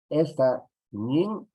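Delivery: Esta ñin slow, ñin rising; stressed ñin. Shown as a demonstration. Teacher names the root of the word, slow and stressed. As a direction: lean on ñin slowly. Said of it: "se va a llamar lexema".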